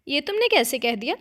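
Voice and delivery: surprised. A narrator's reading. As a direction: caught off guard by her friend. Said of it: "वो हैरानी से बोली". (हल्की हैरानी से)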